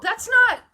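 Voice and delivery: not punched loud and high; a loud, emphatic "not"; stress on not. Real, not acted. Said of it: "emphatic correction".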